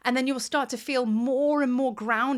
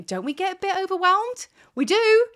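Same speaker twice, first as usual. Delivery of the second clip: climbing higher and louder into an emphatic yes; rising excitement; 8 semitones higher, 5 dB louder than usual. Real, not acted. Speaking to viewers, rising to an excited yes. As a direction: build to a big excited confirmation.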